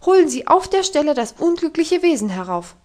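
loud, high command; calling out. Acted. Governess ordering the servant to fetch Heidi, loud command. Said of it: "rief sie ihm zu".

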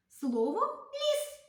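put-on name the word slowly for the children; slow clear naming. Shown as a demonstration. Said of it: "буду називати слова".

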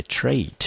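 "trait" said alone as one syllable; 分音节示范. Shown as a demonstration. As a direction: sound out "trait" separately, clearly. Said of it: "先把音节帮各位分一下". (把"trait"作为单独音节清楚念出)

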